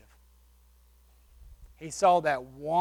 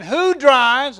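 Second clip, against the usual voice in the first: louder, raised question; a little louder; 6 semitones higher, 10 dB louder than usual. Retold or imitated. Asking again so everyone hears, louder. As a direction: call it out so the whole room hears. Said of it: "a little bit louder".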